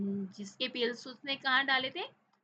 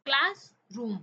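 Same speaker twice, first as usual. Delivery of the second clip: Classroom in two firm beats, class, pause, रूम; syllable by syllable; 2 semitones higher, 7 dB louder, slower than usual. Shown as a demonstration. Teacher counting syllables for the class, syllable by syllable. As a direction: punch out classroom loudly, split in two. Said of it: "कितने syllable है".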